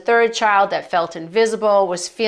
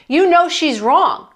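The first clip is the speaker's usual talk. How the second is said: pleading retort; 5 semitones higher, 4 dB louder, slower than usual. Retold or imitated. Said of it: "I said".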